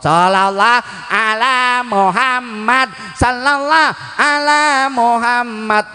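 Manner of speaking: high-pitched, belted chant imitation; straining high, hammed-up chanting; mock yelled sholawat. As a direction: imitate a neighbour bawling the chant. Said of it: "sambil teriak-teriak baca sholawat".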